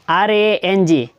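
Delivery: letters said slowly and evenly; आर ए एन जी spelled out slowly; slow spelling. Shown as a demonstration. Teacher spelling a word slowly for students. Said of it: "spelling out letters slowly".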